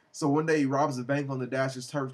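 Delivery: ordinary talk, nothing marked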